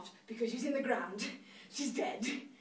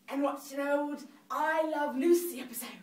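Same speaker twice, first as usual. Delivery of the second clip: ordinary delivery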